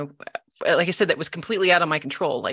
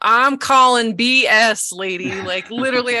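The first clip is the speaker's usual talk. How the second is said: high, louder, drawn-out disbelief; incredulous challenge; 6 semitones higher, 6 dB louder than usual. Retold or imitated. Patient challenging practitioner after five minutes, incredulous. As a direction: call her bluff, loudly sceptical.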